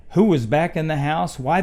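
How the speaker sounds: ordinary delivery, nothing marked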